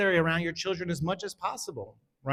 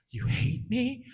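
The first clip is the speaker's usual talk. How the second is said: hurt surprise; 5 semitones higher, slower than usual. Retold or imitated. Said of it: "I was floored".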